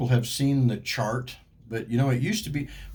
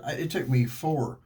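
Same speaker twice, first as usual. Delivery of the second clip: ordinary delivery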